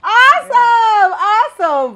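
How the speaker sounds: high, drawn-out cheer; excited praise. Real, not acted. Interviewer praising a vocal coach's freestyle, excited praise. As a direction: stretch it out in delighted approval.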